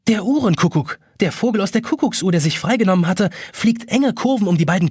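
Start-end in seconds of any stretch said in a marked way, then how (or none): none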